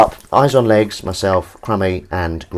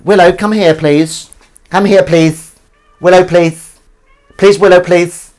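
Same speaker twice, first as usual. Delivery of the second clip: loud, high pleading call; calling the cat; 8 semitones higher, 9 dB louder than usual. Real, not acted. Calling the cat Willow across the room, loud high pleading. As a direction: coax the cat over, begging loudly.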